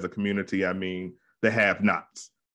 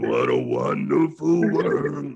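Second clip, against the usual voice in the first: slow, loud growled singer impression; put-on growly jazz crooner, drawn out; 5 semitones higher, 4 dB louder, slower than usual. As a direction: drag it out in a big mock-jazz growl. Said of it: "He got the [name] going".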